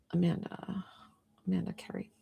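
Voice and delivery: barely voiced, reading under breath; whispered to herself. Real, not acted. Streamer checking a payment app, whispering the name. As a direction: murmur the name as you check.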